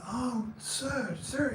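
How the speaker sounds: put-on high-pitched, tentative Mexican accent; attempted Mexican accent. Homeless man answers the grateful owner, attempted Mexican accent. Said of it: "I can't do the accent".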